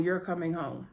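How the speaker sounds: gently promising a home; a soft, kind promise to the boys. Acted. Vi reassures the kids.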